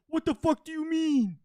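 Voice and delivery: high, slow mock-outraged cry; imitated meme outburst. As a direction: draw it out in exaggerated disbelief. Imitating a well-known yelling meme.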